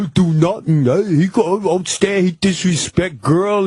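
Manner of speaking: imitating the albino's broken English, in a different voice; broken English impression. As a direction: put on the albino's halting accent.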